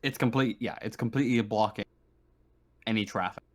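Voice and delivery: normal talking voice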